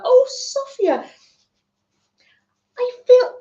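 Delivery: Oh, Sophia rising high and loud, deeply touched; touched delight. Real, not acted. Host touched by Sophia's mail, high delighted cry. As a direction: let it burst out, moved and delighted. Moved by friend's Happy Mail.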